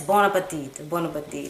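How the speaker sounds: put-on low, soft French-accented sign-off; mock French accent. Reviewer imitating actress, low mock French accent. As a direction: toss it off in a fake French accent. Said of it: "she had the French accent".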